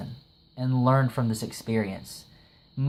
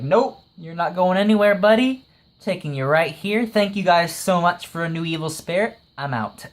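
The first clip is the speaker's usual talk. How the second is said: raised, louder mock-triumphant voice at first; cocky dismissal; 4 semitones higher, 4 dB louder than usual. Spirit controller taking Mogami away as a pet, cocky. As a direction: breezily shut him down, then sign off.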